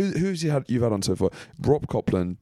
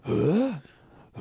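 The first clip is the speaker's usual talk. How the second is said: anime huh; 7 semitones higher than usual. Retold or imitated. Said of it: "it's like".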